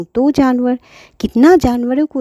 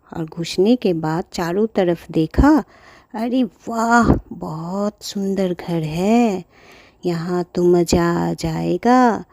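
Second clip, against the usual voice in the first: sneaky thief; 2 semitones lower than usual. Acted. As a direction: creep in, murmur the plan.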